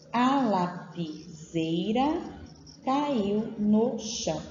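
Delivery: slowly drawn out word by word; slow dictation. Shown as a demonstration. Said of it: "Então, olha só".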